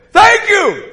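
high, loud reply; called-back thanks. Retold or imitated. Preacher answering an unknown man in a vision, calling out. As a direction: holler thanks back across the distance.

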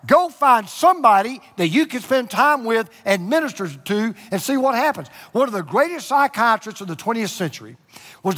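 loud, high, emphatic pulpit delivery; full-force preaching. Acted. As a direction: drive each command home to the congregation.

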